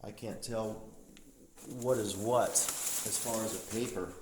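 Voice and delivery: normal talking voice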